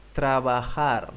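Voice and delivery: trabajar as trabahar, slow; slow model word. Shown as a demonstration. Teacher models trabajar slowly for students. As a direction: stretch trabajar with the J as H.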